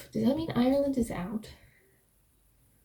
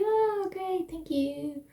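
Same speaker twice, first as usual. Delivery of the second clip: sarcastic; 8 semitones higher, 4 dB quieter, much slower than usual. Retold or imitated. Sarcastic reply to warning.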